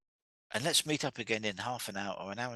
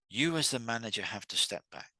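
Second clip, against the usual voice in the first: ordinary delivery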